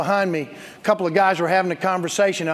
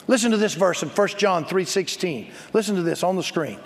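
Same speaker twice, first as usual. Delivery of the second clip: ordinary talk, nothing marked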